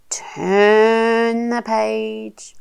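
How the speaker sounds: very slow, stretched words; drawn-out cue. Shown as a demonstration. Helping a little one turn the page, drawn out. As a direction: stretch it out as the page goes over.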